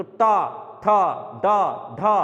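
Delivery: टा ठा डा ढा said loudly with the tongue curled back; retroflex syllables shown. Shown as a demonstration. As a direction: curl the tongue and call out टा ठा डा ढा. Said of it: "टा बोलते जीभ मुड़ जाती है".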